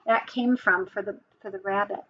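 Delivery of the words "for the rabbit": ordinary delivery, nothing marked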